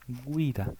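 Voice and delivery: Guida said gwida, u clearly heard; Italian pronunciation. Shown as a demonstration. Teacher repeating the model word for students, gwida. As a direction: repeat Guida slowly, gw sound. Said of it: "la u se pronuncia".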